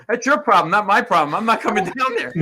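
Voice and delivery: loud, high, imitated indignation; indignant refusal. Young guy to arrested girlfriend on the phone, mock indignant. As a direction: brush her off, acting out his annoyance.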